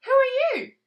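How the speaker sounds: small squeaky question; tadpole voice. Acted. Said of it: "said Tadpole".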